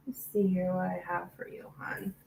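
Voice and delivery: soft breathy whisper to herself. Real, not acted. Reader murmuring to herself over the cards, whispered. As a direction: drop to a hush while shuffling the cards.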